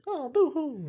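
high-pitched mock crying; mock boo-hoo. Dismissing a film's sadness as not tearful, mock boo-hoo. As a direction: wail a pretend boo-hoo. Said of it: "it's not like".

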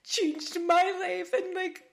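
high, choked-up gratitude; moved to tears, voice lifted; real emotional. Real, not acted. Podcast host telling guest she changed her life, tearfully moved. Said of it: "I'm gonna get emotional".

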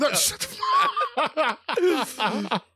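high, slow squeals of laughter; cracking up at the absurd answer; laughing disbelief. Real, not acted. Friend laughing hard at his buddy's DiCaprio guess.

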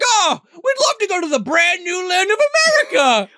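high, gushing mock enthusiasm; mock eager chorus. Villagers answer the invitation to America, mock eager. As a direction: gush it high like an eager crowd. Group's reply voiced in recap.

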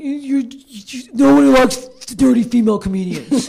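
high, loud mocking voice of dismissive male comics; mocking sexist men. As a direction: sneer it like a smug guy telling her off. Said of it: "all these guys being like".

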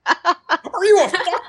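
very high, incredulous outburst; exasperated scolding. Acted. Player berating the teammate who fired, high-pitched exasperation. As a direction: tear into a reckless teammate.

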